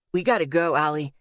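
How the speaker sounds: Asher's voice. A narrator's reading. Asher urging Allie to leave the smoky room, lightly voiced low urging. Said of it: "Asher told me".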